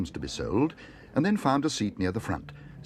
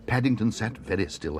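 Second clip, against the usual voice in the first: ordinary delivery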